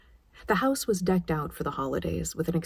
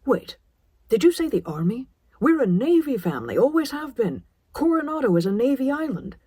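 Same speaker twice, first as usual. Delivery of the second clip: higher and louder, rising alarm; father reeling at the news; alarmed disbelief; 7 semitones higher, 5 dB louder than usual. A narrator's reading.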